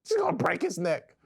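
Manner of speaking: worried wife. Retold or imitated. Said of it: "all the things she would say".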